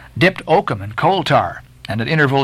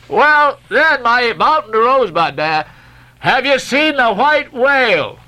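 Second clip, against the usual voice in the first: hailing call; 10 semitones higher than usual. A narrator's reading. Stubb hailing the French mate about the whale. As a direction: call across to the other deck.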